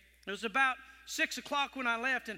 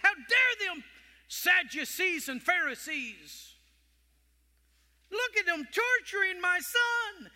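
much higher, louder, outraged grief; indignant father God; 7 semitones higher, 4 dB louder than usual. Pretend. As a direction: cry out at those torturing your son. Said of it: "He could have said".

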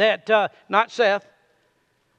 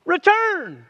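high drawn-out Return; God's call; 7 semitones higher than usual. Acted. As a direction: call Return the way God calls. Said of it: "look what he says".

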